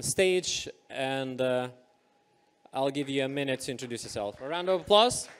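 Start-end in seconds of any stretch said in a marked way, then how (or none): 4.4-5.3 raised, higher call to the crowd; rally the room for a round of applause; host calling out to the audience for applause. Real, not acted.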